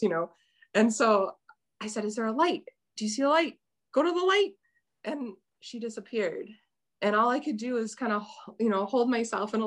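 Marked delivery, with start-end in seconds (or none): 2.0-4.5 urgent plea. Retold or imitated. Urging the child ghost onward.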